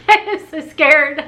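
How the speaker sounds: laughing through a nervous admission. Real, not acted. Vlogger laughing at her own nerves to viewers. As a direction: laugh it off while admitting the fear.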